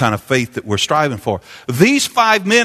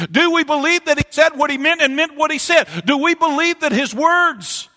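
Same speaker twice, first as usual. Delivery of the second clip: preaching at full force; 11 semitones higher than usual. Acted. Preacher stirring the congregation with the healing woman, full force preaching. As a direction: drive the story home to the congregation. Preacher's rising string of questions.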